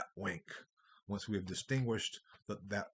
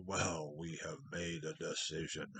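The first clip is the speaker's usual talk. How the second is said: slow Ent voice; 4 semitones lower, 4 dB quieter than usual. Retold or imitated. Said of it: "the Ents talk very slowly".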